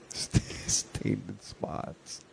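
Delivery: breathy whispered repeat; whispering. Real, not acted. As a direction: whisper it through a laugh.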